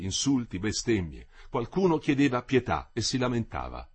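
ordinary delivery

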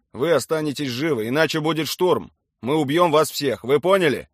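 forceful commander warning the enemy; stern ultimatum. A narrator's reading. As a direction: lay down terms with full authority.

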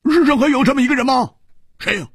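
high, fast, loud blurt. Acted. 胡铁花大声追问那人是谁. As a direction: call it out in amazement. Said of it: "胡铁花大声说".

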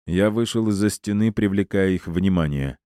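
ordinary delivery

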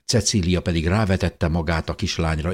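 ordinary delivery

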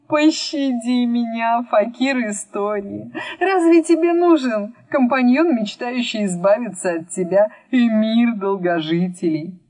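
high, theatrical plea for mercy; grand storyteller pleading. Acted. Storyteller figure begs for mercy.

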